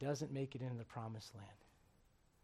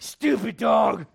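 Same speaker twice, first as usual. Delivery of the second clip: slow, loud, higher exasperated snap; snapping at the dog; 4 semitones higher, 18 dB louder, much slower than usual. Retold or imitated. Frustrated owner at his dog, slow loud snap. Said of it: "I'm like".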